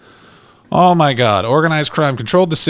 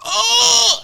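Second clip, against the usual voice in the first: sudden high yelp, like taking a neck punch; high yelp of pain. Retold or imitated. Punched drunk yelping as he falls. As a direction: squeal it out in shock. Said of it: "he just went".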